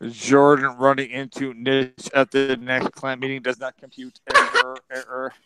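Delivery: monotone robot voice. Acted. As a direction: recite it evenly like a machine. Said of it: "what has Robbie the Robot sound?".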